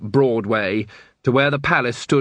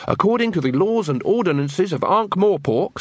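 official reading; 6 semitones higher than usual. Acted. Carrot reads out the law.

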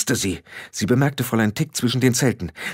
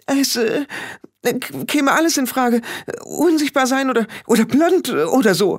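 high stammering babble; high panicked stammering; flustered babble; 12 semitones higher than usual. Acted. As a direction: stumble over the words in panic. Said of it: "plapperte Anagramma".

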